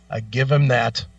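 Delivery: ordinary talk, nothing marked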